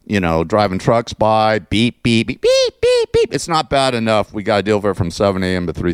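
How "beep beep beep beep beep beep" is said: truck beeps. Retold or imitated. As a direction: squeal the backup alarm of a truck. Imitating truck reversing alarms.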